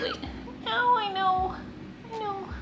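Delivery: high, sing-song soothing of a kitten; calm the squirming kitten in a high voice. Real, not acted. Talking to scratching kitten.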